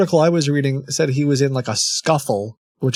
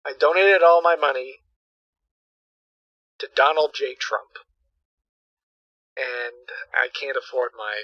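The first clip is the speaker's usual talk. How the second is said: mock Florida accent. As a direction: play a gullible Florida retiree with a Southern twang. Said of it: "Nobody in Florida has that accent".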